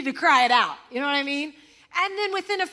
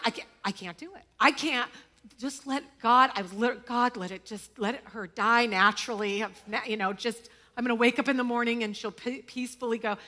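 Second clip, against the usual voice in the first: devastated; 5 semitones lower, 3 dB quieter than usual. Retold or imitated. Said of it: "And I am devastated".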